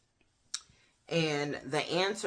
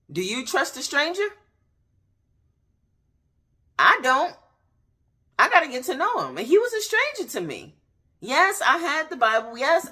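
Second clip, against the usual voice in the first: much higher and louder, driving preach; fiery preaching; 10 semitones higher, 7 dB louder than usual. Acted. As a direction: preach it hard to the congregation. Preaching at full force.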